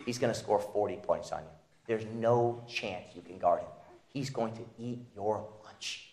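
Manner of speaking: lightly voiced coach, low and hushed; taunting coach. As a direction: needle him like an old coach psyching you up. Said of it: "He goes".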